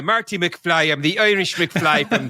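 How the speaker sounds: put-on slow, overdone movie Irish accent; mock the fake Irish of old films, stage Irish accent; hammed-up stage Irish. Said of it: "hoying up the Irish with a hoy diddly doy".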